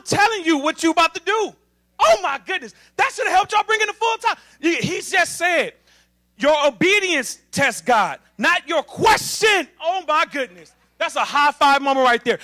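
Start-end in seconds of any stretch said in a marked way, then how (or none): 6.4-9.7 slow, loud preaching shout; proclaiming shout. Retold or imitated. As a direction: thunder out God's words. Preacher voicing God's point.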